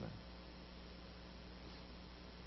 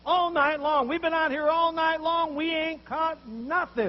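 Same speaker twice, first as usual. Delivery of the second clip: loud, higher, exasperated protest; louder, higher frustrated complaint, slow at the end; complaining fishermen; 8 semitones higher, 9 dB louder, slower than usual. Retold or imitated. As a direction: whine it out after a fruitless night. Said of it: "what some of you would say".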